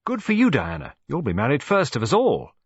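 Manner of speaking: put-on high, loud happy child; excited cheer. A narrator's reading. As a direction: kinderen juichen voor Diana.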